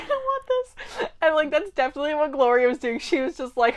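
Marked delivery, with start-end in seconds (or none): 0.0-1.1 put-on much higher, slow, tiny whiny kid voice; sulky whine. Quoting herself giving up the banana, tiny whiny voice. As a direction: whimper it like a pouting child.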